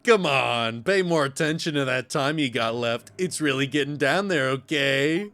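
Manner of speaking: usual speaking voice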